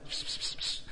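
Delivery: whispered "بس بس بس" like calling a cat; mock hissing call. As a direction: hiss "بس" softly through the teeth. Young man hissing at girls. (همس "بس بس" كأنه ينادي قطة)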